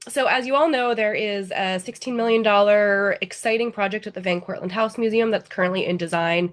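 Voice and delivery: normal talking voice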